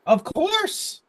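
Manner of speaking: very high, eager gnome voice; obliging gnome. Acted. Gnome agrees to visitor's request, high obliging voice. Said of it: "he's like".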